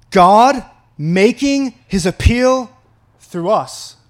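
drawn out, each word weighed; slow and heavy; slow emphatic read. Acted. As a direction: linger on every word of the verse. Preacher dwelling on key phrase.

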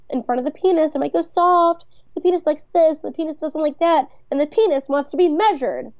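a hint of a furious wife ranting; mock angry rant. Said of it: "And she says".

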